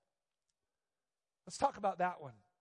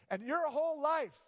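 higher, louder fervent preaching; 9 semitones higher, 5 dB louder than usual. Acted. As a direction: build the sermon with force. Preaching at full force.

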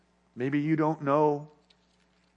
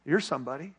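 lower, softer calm reply; 4 semitones lower, 6 dB quieter, faster than usual. Retold or imitated. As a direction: answer gently, quietly firm. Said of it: "the pastor just calmly said".

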